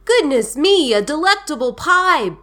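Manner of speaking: high, loud storybook wonder; delighted amazement. A narrator's reading. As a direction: exclaim over a treat as the bear. Bear marvels at the pie.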